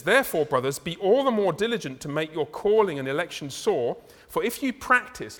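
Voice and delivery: loud, measured Bible reading; proclaiming the text to the congregation; scripture reading. Acted. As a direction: read the verses out with weight. Reads scripture aloud.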